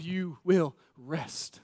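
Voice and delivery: slow, hushed and gentle; quiet reassuring whisper of rest; soft tender promise. Acted. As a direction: lay it down softly like a comfort. Tender imagined words of Jesus.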